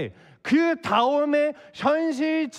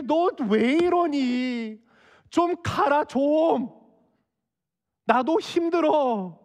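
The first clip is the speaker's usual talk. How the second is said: drawn-out, higher-pitched weary plea; acted exasperation, stretching the words out; exasperated mother-in-law; 3 semitones higher, much slower than usual. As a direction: beg her to leave, worn out and whiny. Exasperated mother-in-law pleading with Ruth.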